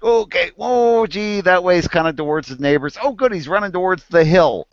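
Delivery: high, anxious retelling of his own thoughts; mock nervous self. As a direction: play your past self fretting as the dog runs. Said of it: "I was kinda like".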